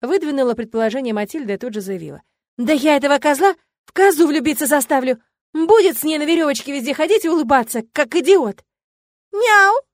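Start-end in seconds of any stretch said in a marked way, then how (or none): 2.6-8.6 loud, raised-pitch vow of revenge; heated, feisty threatening; indignant threat. A narrator's reading. Friend vows revenge on offender. 9.3-9.8 high, loud meow; emphatic little familiar's mew; decisive cat meow. A narrator's reading. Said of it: "решительно поддержал её фамильяр Бау".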